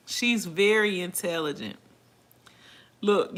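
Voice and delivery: ordinary talk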